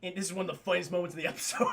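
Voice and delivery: ordinary delivery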